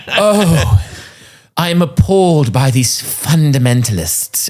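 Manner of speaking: haughty British sneer, slowed; mock British accent. Mocking a lofty pastor in a British accent. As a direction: look down your nose in a posh British accent. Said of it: "I don't know why I'm making him British".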